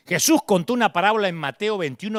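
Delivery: usual speaking voice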